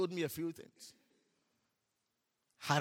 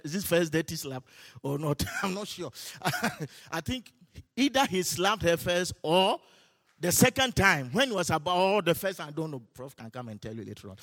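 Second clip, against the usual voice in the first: ordinary talk, nothing marked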